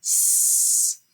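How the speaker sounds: S said as a long unvoiced hiss; held hiss. Shown as a demonstration. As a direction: hiss the S sound. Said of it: "Can you make that sound for me".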